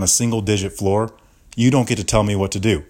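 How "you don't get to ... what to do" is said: usual speaking voice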